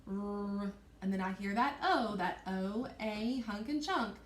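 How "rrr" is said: "r" held as a long continuous sound; stretched out. Shown as a demonstration. Teacher sounding out road for students, "r" held long. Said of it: "I'm gonna stretch it out".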